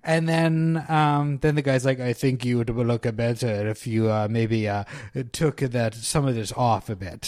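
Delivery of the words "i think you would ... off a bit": lower, badly faked Eastern European accent. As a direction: clumsily imitate an Eastern European man. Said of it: "I'm terrible at accents".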